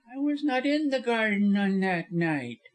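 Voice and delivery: high-pitched lawyer protest; high, defensive character voice; indignant denial. A narrator's reading. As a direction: deny it hotly.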